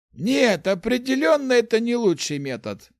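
usual speaking voice

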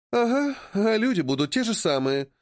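high, eye-rolling sarcasm; sarcastic. A narrator's reading. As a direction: roll your eyes as you say it. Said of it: "закатывает глаза Алиса".

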